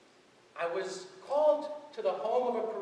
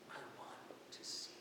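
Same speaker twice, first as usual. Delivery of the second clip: hushed, unvoiced breath of a request; whispered plea; 8 semitones lower, 18 dB quieter than usual. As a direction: whisper it, barely there. Blind man answers Jesus softly.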